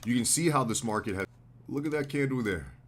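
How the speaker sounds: ordinary talk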